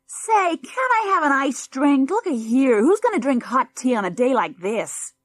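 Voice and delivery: put-on high, loud American kid demanding; whiny spoilt boy. A narrator's reading. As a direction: pitch it up as a bratty boy whining.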